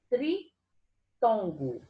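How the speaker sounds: "tritongo" slowly split as tri - tongo; separação silábica. Shown as a demonstration. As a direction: pause after tri in "tritongo", drawing it out. Teacher spells out the key term. ("tritongo" pausado: tri, tongo)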